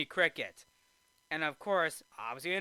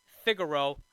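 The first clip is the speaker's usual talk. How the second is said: ordinary talk, nothing marked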